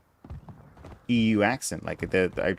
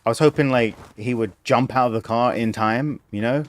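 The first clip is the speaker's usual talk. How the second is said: usual speaking voice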